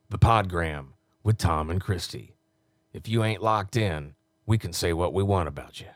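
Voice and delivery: low, punchy promo read; low-pitched radio imaging voice; radio announcer. Acted. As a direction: deliver it like a station liner. Records promo liners in announcer voice.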